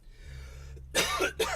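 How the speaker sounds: hacking cough after a hit; heavy coughing fit. Real, not acted. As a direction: cough hard and raspy.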